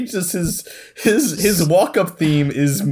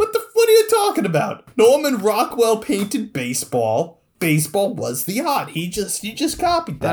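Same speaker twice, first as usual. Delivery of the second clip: high-pitched, incredulous protest; indignant mascot; 7 semitones higher than usual. Acted. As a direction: argue back in an indignant, squeaky-high character voice. Mascot indignantly argues baseball is art.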